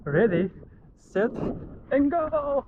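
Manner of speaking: rising, eager launch call; excited countdown. Real, not acted. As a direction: pitch it up as you leap. Countdown before jumping in.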